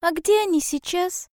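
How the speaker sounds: high, curious small-animal voice; wondering little hedgehog. Acted. Hedgehog asking owl about the birds, high curious voice. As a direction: ask it high, full of wonder.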